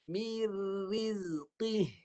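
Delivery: Mir rizqi slowly, qi thick from the tongue root; thick qaf recitation. Shown as a demonstration. Teacher models thick qaf for the student. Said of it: "Qaf pangkal lidah naik".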